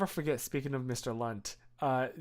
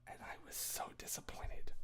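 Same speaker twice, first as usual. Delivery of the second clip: whispered disappointment; 14 dB quieter than usual. Real, not acted. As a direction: breathe it out in a whisper. Recalling the contest loss.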